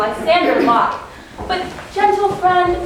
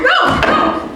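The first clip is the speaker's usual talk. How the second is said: anguished; 13 semitones higher, 3 dB louder than usual. Acted. Helena rejects her own thought.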